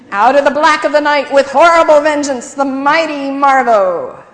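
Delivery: loud, high dramatic hero narration; comic book announcer. A narrator's reading. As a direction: boom it like a superhero intro. Gladys declaiming comic book.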